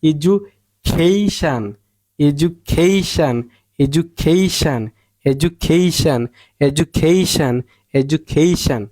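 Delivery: এজুকেইশান slow, "kei" long and strong; stressed "kei". Shown as a demonstration. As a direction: drill এজুকেইশান leaning on "kei". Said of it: "বড় করে বলতে হবে". (এজুকেইশান, "কেই" বড় করে জোর দিয়ে)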